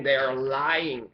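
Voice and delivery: each word slow and weighted; slow emphatic accusation. Real, not acted. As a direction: drive it home slowly: they are lying. Angry accusation against police.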